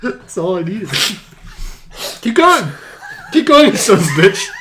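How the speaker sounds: high-pitched laughing plea; laughing urging. Real, not acted. Cohost begging him on, squeaking with laughter.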